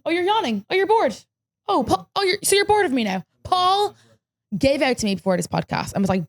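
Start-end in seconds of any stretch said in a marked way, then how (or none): 0.0-4.0 higher, teasing mock hurt; mock offended. Real, not acted. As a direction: playfully scold a bored friend. Calls out Paul yawning on podcast.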